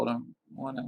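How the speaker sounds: ordinary delivery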